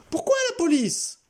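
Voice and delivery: high, startled question; suddenly wary intruder. A narrator's reading. Intruder backs down at the word police. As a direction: back off fast.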